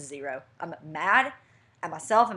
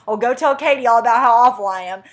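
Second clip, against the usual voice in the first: loud, higher mock outburst; angry retort; 5 semitones higher, 14 dB louder than usual. Fiance to woman leaving car to see Katie, angry retort. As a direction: throw it back loudly, hammed-up indignation. Retold quarrel, fiance shouts back.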